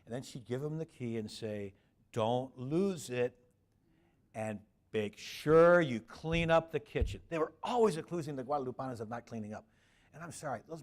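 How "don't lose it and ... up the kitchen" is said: slow, loud, bossy warning; hammed-up stern parish secretary handing over a key; scolding secretary. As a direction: lay down the law word by word.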